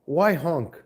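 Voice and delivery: ordinary delivery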